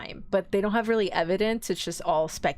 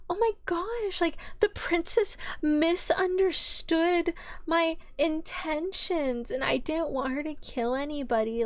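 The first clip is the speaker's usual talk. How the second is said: playing the victim; 7 semitones higher than usual. Accused woman blames the princess, mock victim whine. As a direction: put on a hammed-up poor-me act. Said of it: "she was pulling the victim card".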